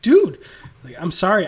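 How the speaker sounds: high, loud eager dude; eager outburst. Retold or imitated. Miles bursts out apologizing to Peter, eager and loud. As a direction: blurt it out, pleading.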